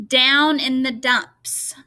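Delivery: slow, deliberate reading of Down in the dumps; slow example phrase. Shown as a demonstration. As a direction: lean on each D in Down in the dumps. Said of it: "See the D, the duh?".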